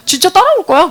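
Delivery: high, loud disbelieving question; retold amazed reaction; surprised double-check. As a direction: ask it as if you can't believe it. Acted surprise, asks again.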